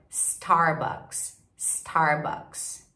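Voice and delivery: "Starbucks" with held American R; English-style "Starbucks". Shown as a demonstration. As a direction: model "Starbucks" slowly, starting on S. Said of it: "eles falam Star".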